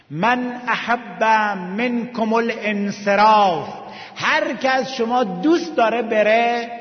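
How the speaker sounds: high, loud, slow cry of the Imam's words; impassioned preaching. Acted. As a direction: call out each phrase at full force, stretching the Arabic. Preacher voices Imam releasing companions.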